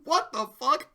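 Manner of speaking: rising disbelief; mock disbelief. To himself after recording, rising mock disbelief. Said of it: "I was like".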